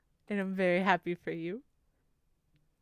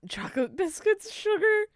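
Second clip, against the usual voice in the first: high, loud incredulous scolding; exasperated nagging; 10 semitones higher, 9 dB louder than usual. Real, not acted. Streamer urging Venom toward vitamins and protein, exasperated nagging. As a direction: fuss loudly over a bad diet, voice pitched way up. Scolding chatter over poor diet.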